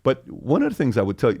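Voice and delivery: ordinary talk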